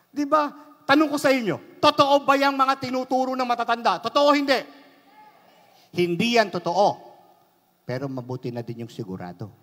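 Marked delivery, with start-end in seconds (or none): 0.1-7.0 high, loud pulpit delivery; fiery sermon voice; preaching at full force. Acted. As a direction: drive it home to the congregation.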